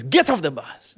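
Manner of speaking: loud, forceful acted command; stern order. Driver ordering passenger off the bus, loud stern command. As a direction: order him out sharply.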